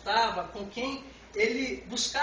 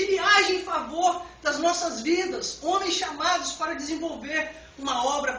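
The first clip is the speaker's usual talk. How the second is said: raised, driving sermon delivery; forceful preaching; 5 semitones higher, 5 dB louder than usual. Real, not acted. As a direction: proclaim it to the congregation with rising intensity. (pregação enérgica, em tom elevado)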